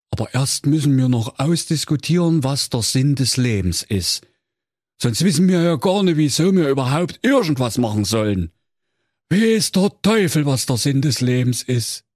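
lightly voiced tired villager; weary villager. A narrator's reading. Villager explaining, a hint of weariness. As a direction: plead feebly.

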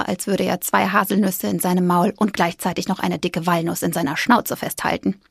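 ordinary delivery

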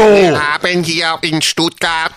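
slow, vehement Stuttgart politician; drawn-out Swabian speech; mock Swabian politician. Continues the Swabian politician parody.

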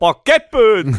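loud, high-pitched mock Saxon; Saxon accent. Friends riffing on a hotline agent from Saxony, loud Saxon accent. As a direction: exclaim it in hammed-up Saxon. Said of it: "Und dann hast du jemand aus Sachsen dran".

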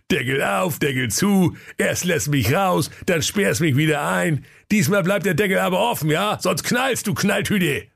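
high, mocking workman voice; cheeky workman, teasing him; cheeky sewer worker. Sewer worker scolds Peter. (frecher Kanalarbeiter, spöttisch)

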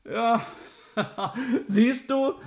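high giggle; laughing through the words. Acted. Laughs at Ole's answer.

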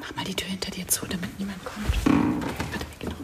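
soft urgent whisper. Acted. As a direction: hush it so no one hears. Hiding behind the door.

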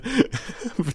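short high laugh. Real, not acted. Laughs at friend. (hohes Lachen)

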